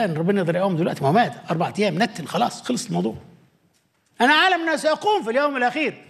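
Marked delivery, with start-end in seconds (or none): 4.2-6.0 mild Martha protest. Acted. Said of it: "زي مرسى".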